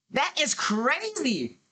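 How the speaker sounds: high, very drawn-out astonishment; stunned and incredulous; amazed disbelief. Real, not acted. Streamer baffled by the accent claim.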